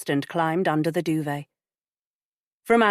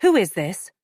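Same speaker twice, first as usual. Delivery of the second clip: firm; 3 semitones higher, 5 dB louder than usual. Acted. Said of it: "she spoke firmly".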